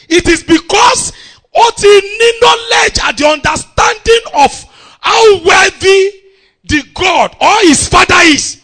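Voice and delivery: loud, high-pitched sermon delivery; full-force preaching. Real, not acted. Preacher to congregation on God's wealth, full-force preaching. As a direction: drive the point home at full voice.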